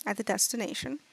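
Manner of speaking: soft, hushed dragon voice. Acted. Dragon bids Salem farewell before the walk, softly. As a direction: fade to a breathy, courteous murmur. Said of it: "the dragon sort of just looks at you and goes".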